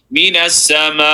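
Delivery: "Mina sama" with the last vowel held for several beats; extended vowel. Shown as a demonstration. Teacher demonstrating the big squiggly line, very long final vowel in "Mina sama". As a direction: recite "Mina sama", sustaining the final "a" on a level note. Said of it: "make the sound longer".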